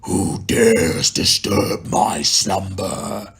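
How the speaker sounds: slow, booming cave guardian voice; giant tiger head. Retold or imitated. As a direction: drag out a huge beast's ancient challenge. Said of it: "the giant C G tiger head's like".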